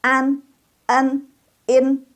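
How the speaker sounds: An, en, in, high and level; model nasal endings. Shown as a demonstration. Teacher models sounds like Indonesian, high and spaced. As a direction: hold An, en, in apart on one high pitch. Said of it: "sama dengan bahasa Indonesia".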